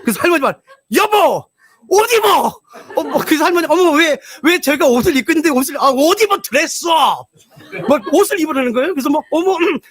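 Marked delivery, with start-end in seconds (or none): 1.0-2.5 excited shout. Retold or imitated. Grandpa shouting at wife. 3.7-6.8 excited. Retold or imitated. Couple's excited exchange.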